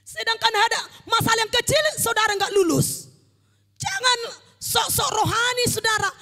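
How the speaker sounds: fiery warning =3; forceful preaching. Real, not acted. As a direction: scold the congregation from the pulpit.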